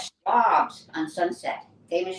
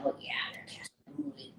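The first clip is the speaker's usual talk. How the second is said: whispered, unsure; whispered to herself at the door; whispered, doubtful of the dogs man; hushed aside; 6 semitones higher, 13 dB quieter than usual. Retold or imitated. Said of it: "I'm going".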